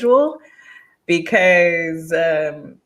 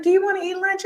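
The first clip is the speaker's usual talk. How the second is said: much higher, bright invite; eager roommate; faster than usual. Retold or imitated. Spanish roommates inviting her to lunch, high eager mock invite. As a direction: mimic the eager roommates asking you out. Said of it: "they were like".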